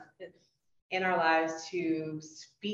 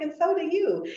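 gracious woman; 12 semitones higher, 7 dB louder than usual. Retold or imitated. Voicing the complimented woman.

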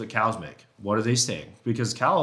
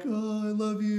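much higher, swooning whine; lovesick bull voice; 11 semitones higher than usual. Retold or imitated. Bull answering the cow calls, high and lovesick. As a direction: sigh it like a smitten bull. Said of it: "And he was just like".

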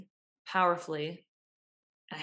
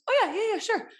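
much higher, breezy brush-off; hammed-up distracted waiter; rushed waiter; 5 dB louder than usual. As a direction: toss it off like a busy waiter.

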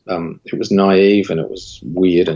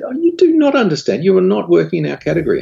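hammed-up indignant client, much higher and fast; furious client; 12 semitones higher, faster than usual. Marketing manager scolding the agency on the phone, mock furious, high and fast. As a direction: mimic her outrage in a high, rushed voice. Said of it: "she was furious".

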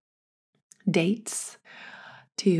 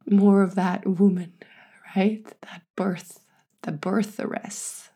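usual speaking voice

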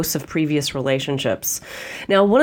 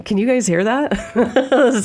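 laughing; 6 semitones higher, slower than usual. Real, not acted. Startled by wind noise, laughing.

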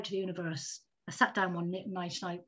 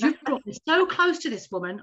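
pleading with the universe; 6 semitones higher, 6 dB louder than usual. Retold or imitated. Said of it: "I said".

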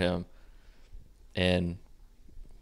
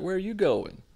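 high, incredulous-sounding mock question; imitated girlfriend; 10 semitones higher than usual. Girlfriend stopping him at the bedroom, high mock challenge. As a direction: voice her in a much higher pitch.

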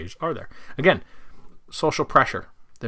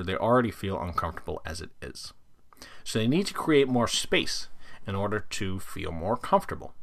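whisper; 2 semitones lower, 5 dB quieter than usual. Real, not acted. Explaining handlers' discomfort.

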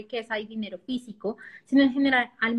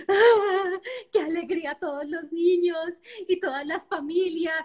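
mock happy crying; 5 semitones higher than usual. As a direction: ham up crying with happiness. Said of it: "y yo así llorando".

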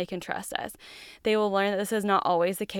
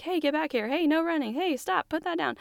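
higher, constant warning commands; nagging parent; 8 semitones higher than usual. Retold or imitated. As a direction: keep calling out orders all day. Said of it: "all day long I'm like".

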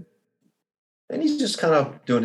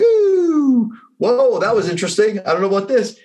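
squealing high at the first surprise, then easing down; a jolted rider caught off guard, much higher; startled whoa; 8 semitones higher than usual. Retold or imitated. First drop on roller coaster.

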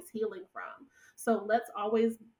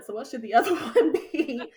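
higher, louder, laughing through it; amused, raised-voice question; 3 semitones higher, 10 dB louder than usual. Retold or imitated. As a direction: ask it with a laugh breaking in. Joking question to her mentor.

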